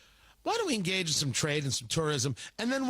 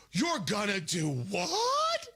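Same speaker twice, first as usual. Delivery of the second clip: voice up; much slower than usual. Acted. The left reacting to moving the embassy, voice up. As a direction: drag out the last word as it shoots up. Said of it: "with the voice up and everything".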